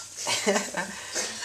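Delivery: soft, voiceless chuckle; quiet breath of laughter. Real, not acted. Host chuckles at own joke about greasy hands, soft laugh.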